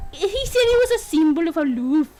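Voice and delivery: attempted Scottish accent. Bride recalls her husband's words, in an attempted Scottish accent. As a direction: lilt it like an amateur Scot. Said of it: "could do a Scottish accent".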